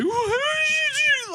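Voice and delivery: very high, slow mock wail; hammed-up sobbing shriek; imitated crying. Crying teacher confronting a student, mock wail. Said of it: "Shaking, crying".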